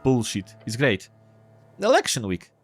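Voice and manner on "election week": put-on high-pitched kid reading headlines in an uncertain accent; boy with muddled accent. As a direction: read the paper aloud as a squeaky accented boy. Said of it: "what I'm doing with this accent".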